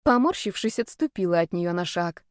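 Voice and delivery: ordinary delivery, nothing marked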